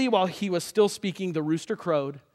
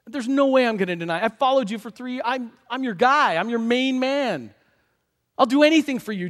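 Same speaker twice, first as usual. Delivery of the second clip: higher, earnest loyal vow; insistent protest; 6 semitones higher, 4 dB louder than usual. Acted. Peter swears loyalty to Jesus, insistent protest. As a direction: protest your devotion, pitch up.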